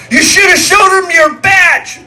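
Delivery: loud, high, drawn-out yell; mocking shout. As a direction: holler the punchline as a taunt. Punchline called out to fleeing warden.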